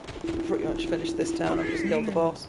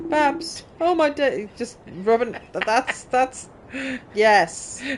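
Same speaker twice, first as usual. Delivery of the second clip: a touch higher, dazed then delighted hero; lightly voiced game character; 8 semitones higher than usual. Gamer reading the revived character greeting her friend, lightly voiced. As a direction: play the woken hero, surprised then gushing.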